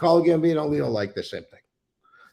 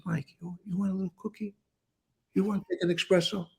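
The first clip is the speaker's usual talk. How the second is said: a hint of a soft-spoken old boss, much softer; softly offering a cookie, lightly voiced old man; low-spoken old mobster; 5 semitones higher, 6 dB quieter than usual. Old mob boss offering young Mike a cookie, softly. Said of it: "They spoke very low".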